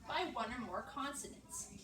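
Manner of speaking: usual speaking voice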